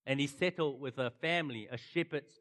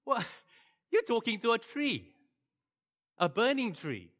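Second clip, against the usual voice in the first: higher, louder, incredulous friends teasing; mocking friends; 10 semitones higher, 6 dB louder than usual. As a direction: scoff at him like he's lost his marbles. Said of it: "His friends must be like".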